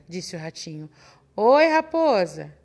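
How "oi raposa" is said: plain reading voice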